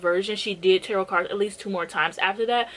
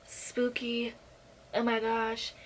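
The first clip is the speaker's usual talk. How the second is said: a hammed-up spooky ooh, softer and breathy; mock spooky; 2 semitones higher, 8 dB quieter, much slower than usual. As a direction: tease it like a cheesy ghost story. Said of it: "it's like".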